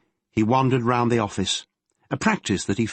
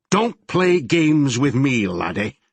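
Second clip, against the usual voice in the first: slow, louder Glaswegian snarl; snarled threat; 5 semitones higher, 4 dB louder, slower than usual. Acted. Detective menacing the lying promoter, snarling slowly. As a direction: lean in and growl the threat slowly. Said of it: "Chadwick snarled".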